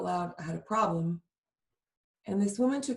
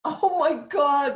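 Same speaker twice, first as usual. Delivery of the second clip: slow, higher and louder "Oh my God" in amazement; stunned oh my God; 7 semitones higher, 7 dB louder, much slower than usual. Real, not acted. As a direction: stretch it out, truly astonished. Hearing mother threw dolls out.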